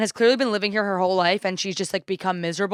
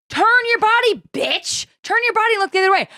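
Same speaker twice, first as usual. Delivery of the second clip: very high-pitched indignant yell; hammed-up fury at a stranger; exasperated outburst; 11 semitones higher than usual. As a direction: let it fly, high and outraged. Re-enacting fury at the woman.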